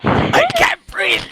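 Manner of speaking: high, slow, hammed-up strangled cry; overplayed gasping complaint; mock suffocating. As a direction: squeal it like someone crushed underneath. Mock suffocating under character.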